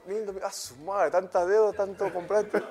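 half-laughing complaint; amused grumble about his mother's spending. Real, not acted. Laughing while recalling mother's spending.

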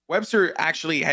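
ordinary delivery

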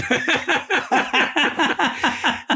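real laugh running long; long high laugh. Real, not acted. Laughing at the memory of the diner, long laugh. Laughing at own name line.

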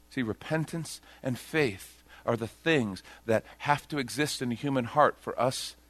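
ordinary delivery

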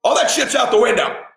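high, urgent shouting at the son; intense, strained rallying cry; fired-up pep talk. Retold or imitated. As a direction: yell it like a coach rallying a player. Dad firing up his scared son.

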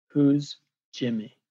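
slow, deliberate retold challenge; measured, dramatic question; slow, pointed question. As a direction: stop him with slow, weighted words. Retold dead-in-the-eye confrontation.

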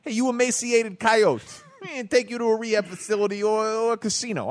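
imitating the Chicago accent. Host imitates the coyote video man.

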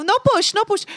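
high, pleading broken English; mock pleading. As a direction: beg him not to push. Retold broken-English protest.

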